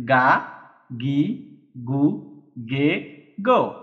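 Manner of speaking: "গা, গি, গু, গে, গো" read slowly, one syllable at a time, g voiced. Shown as a demonstration. Teacher shows students the dakuten readings, carefully enunciated. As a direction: spell out "গা" "গি" "গু" "গে" "গো" with a hard voiced g. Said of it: "উচ্চারণ হয়ে যাবে".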